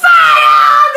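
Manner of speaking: high, full-force alarm yell; old woman yelling. A narrator's reading. Old woman raising the fire alarm, yelling. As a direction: yell the alarm at the top of your voice. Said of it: "the old woman yelled".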